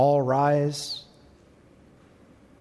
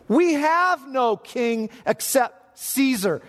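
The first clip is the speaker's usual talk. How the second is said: high, slow, weighty words of the priests; emphatic declaration; 10 semitones higher, slower than usual. Acted. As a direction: spell out each word with awful weight. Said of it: "Here's the culmination of it".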